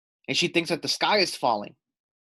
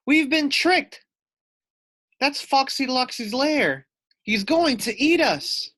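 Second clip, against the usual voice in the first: high, loud alarmed cry; panicked wail; 11 semitones higher, 5 dB louder than usual. Acted. Loony Little warning friends of the fox's lair. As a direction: sound the alarm in terror. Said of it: "Loony Little wailed".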